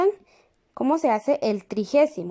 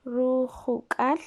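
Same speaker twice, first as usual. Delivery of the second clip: drawn out syllable by syllable; slow dictation; 3 dB quieter, much slower than usual. Shown as a demonstration. Teacher to class while writing, slowly drawn out. Teacher writes word on board.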